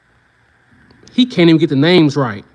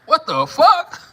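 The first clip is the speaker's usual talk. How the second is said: mock shocked brother; 9 semitones higher, slower than usual. Imitating brother's reaction.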